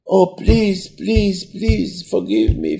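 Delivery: high, plaintive acted begging; tearful pleading. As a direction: beg forgiveness as if about to cry. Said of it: "he starts crying".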